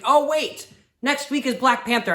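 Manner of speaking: surprised at forgetting; sudden realization. Real, not acted. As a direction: caught by a sudden memory.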